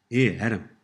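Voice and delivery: "Here, Adam" with a flapped R between vowels. Shown as a demonstration. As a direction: tap the R in "Here, Adam" like American tt in better. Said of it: "Here the R is pronounced as a flap".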